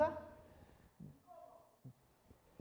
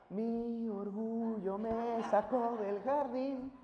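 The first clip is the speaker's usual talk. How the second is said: slow quote; much slower than usual. Retold or imitated. Quotes a song title slowly.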